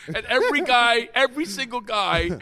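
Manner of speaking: ordinary talk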